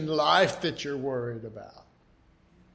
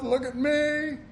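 mock self-praise; 9 semitones higher than usual.

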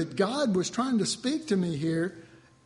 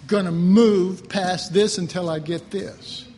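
louder, fervent resolve; determined vow; 6 dB louder than usual. Retold or imitated. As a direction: swear it with full force. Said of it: "I said".

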